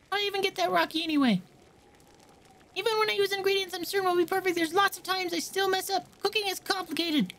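put-on high, fussy young cook voice; high-pitched cook girl.

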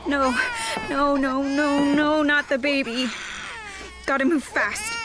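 loud, crying panic; panicked cry. Acted. Cassie sees the baby in danger, panicked crying. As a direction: cry out as you rush in.

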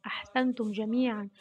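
usual speaking voice

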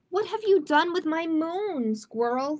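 high-pitched accusing snap; mildly cross storybook duck; snappish. Acted. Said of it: "snapped Duck".

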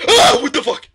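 real jump-scare yell, high and very loud; high-pitched startled shout; startled scream. Real, not acted. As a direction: yelp loudly in sudden shock. Jump scare in horror game.

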